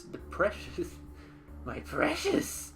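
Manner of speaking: high, slow, whispered creature voice; Gollum voice. Pretend. Hissing ring-obsessed creature voice.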